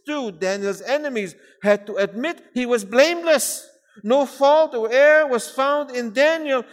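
declamatory reading, swelling loud and high on key points; ringing, measured pulpit recitation; emphatic preaching. Acted. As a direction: proclaim each point to the congregation. Declaims a list of comparisons.

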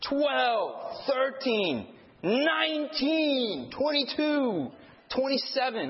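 high-pitched mock yelp of each number; mad counting. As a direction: imitate a furious kid counting, drawn out and high. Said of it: "he was so mad".

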